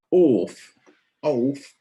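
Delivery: Orf said with a long open vowel in Cockney style. Shown as a demonstration. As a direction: say Orf and oaf as a Londoner would say off. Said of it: "oaf means off".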